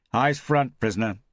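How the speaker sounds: sharp, loud and high guard's command; barked order. Acted. Guard barking at the strapped-down prisoner, loud and high. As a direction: snap it at him like a prison guard.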